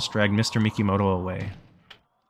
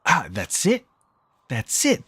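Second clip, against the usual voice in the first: higher-pitched excited exclamation; sudden realization; 6 semitones higher, slower than usual. Acted. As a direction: react as if it just clicked. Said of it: "Toshio ought to strongly react to something unexpected".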